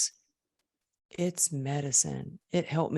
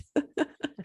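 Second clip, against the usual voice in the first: short breathy high laugh; high giggle; 11 semitones higher, 3 dB quieter than usual. Real, not acted. Laughing at her own understatement.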